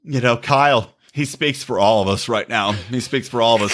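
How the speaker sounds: low, lightly put-on imitation; understated mock-serious endorsement; mock earnest agreement. Mimicking women on Twitter, low voice.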